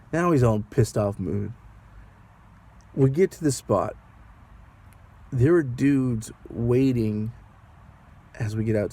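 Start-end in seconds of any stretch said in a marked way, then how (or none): none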